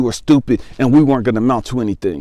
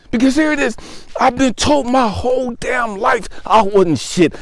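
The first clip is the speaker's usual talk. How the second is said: raw, pitched-up anguish; reliving the shame, voice straining higher; 10 semitones higher than usual. Real, not acted. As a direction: near tears, words pushed out high. Reliving breakdown, voice strained high.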